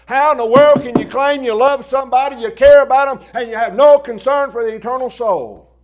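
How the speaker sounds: high, loud street preaching; full-force preaching. Acted. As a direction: thunder it to the crowd.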